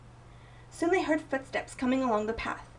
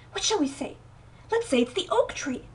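plain reading voice

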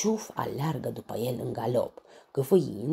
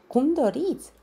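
very high squeak, louder; squeaky; 8 dB louder than usual. A narrator's reading. Tafiti squeaking nervously to the lion, very high. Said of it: "se pițigăie Tafiti".

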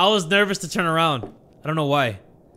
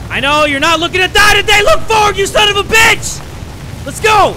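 high, full-volume shouting; loud, high-pitched gamer rage; frantic yelling; 9 dB louder than usual. Real, not acted. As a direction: yell furiously at your team to move. Yelling in game.

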